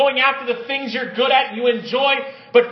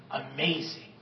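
much softer, almost breathed; hushed marvel; 8 semitones lower, 13 dB quieter than usual. Real, not acted. Preacher marvels at God's rescue, hushed. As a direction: let it fall away in awe.

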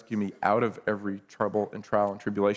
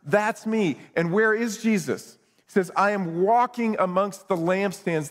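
raised, high proclaiming preacher voice; fervent preaching; 7 semitones higher than usual. Acted. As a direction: proclaim it over the congregation. Preaching at full force.

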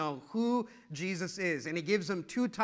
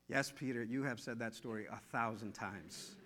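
weary reply; 8 semitones lower, 11 dB quieter than usual. Pretend. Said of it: "Mark answering, weary".

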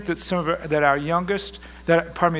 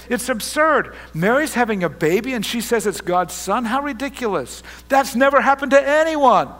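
acted incredulous protest, raised pitch and voice; angry confusion; 4 semitones higher, 6 dB louder than usual. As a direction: protest like a baffled, betrayed fiancé. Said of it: "anger, sense of betrayal, if you will, and even confusion".

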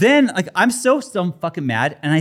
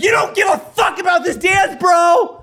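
high yelled bro; retold shout; 11 semitones higher than usual. Shouting at the man during the recital. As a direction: shout the accusation. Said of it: "I'm like".

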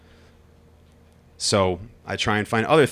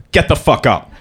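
loud, stern command from dad; barked order; 4 semitones higher, 8 dB louder than usual. Retold or imitated. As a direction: snap him out of bed. Father ordering son out of bed.

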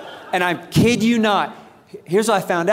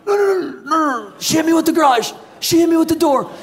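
desperate protest; 8 semitones higher than usual. Retold or imitated. Injured husband trying to tell paramedics, panicked high squeal. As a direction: frantically insist it was her. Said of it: "I'm like".

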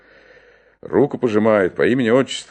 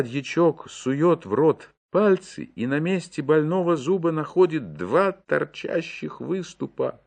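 usual speaking voice

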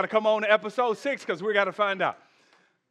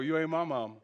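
much softer, defiant comeback; sassy translation; 6 semitones lower, 7 dB quieter, faster than usual. Retold or imitated. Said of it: "let me translate".

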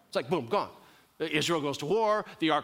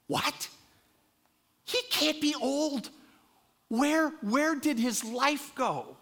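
much higher, incredulous squeak, slowing on the last question; mock disbelief; 9 semitones higher, slower than usual. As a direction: act stunned that he's suddenly old. Shock at Samuel suddenly old.

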